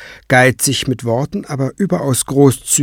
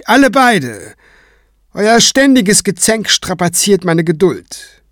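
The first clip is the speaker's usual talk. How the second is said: higher, tired, stately reproach; weary rebuke; 8 semitones higher than usual. Acted. High priest rebuking Kranz and Craven, weary and stately. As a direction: scold them like squabbling children.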